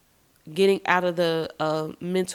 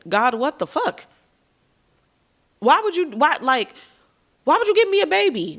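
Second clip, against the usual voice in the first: rising to a loud, much higher outraged cry; angry at God; 8 semitones higher, 4 dB louder than usual. Retold or imitated. Newly pregnant, she yells at God in outrage. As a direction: demand an answer from God, building to a yell. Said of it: "I was pissed".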